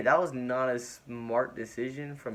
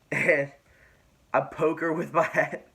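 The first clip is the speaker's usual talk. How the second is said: laughing retelling; 5 semitones higher, 5 dB louder than usual. Real, not acted. Laughing while retelling his blunder.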